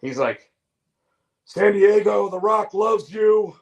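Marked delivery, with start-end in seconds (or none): 1.4-2.3 loud, high wrestler promo voice with a Southern twang; The Rock. Acted. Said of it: "Why does your Rock sound like fucking Stone Cold?". 2.3-3.6 high, slow wrestler shout with a Southern twang; The Rock. Acted. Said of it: "Why does your Rock sound like fucking Stone Cold?".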